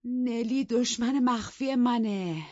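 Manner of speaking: slow, high, loud accusing cry; wife shouting that Nelly betrayed her; angry shout. Acted. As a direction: denounce Nelly slowly. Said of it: "با عصبانیت داد زد".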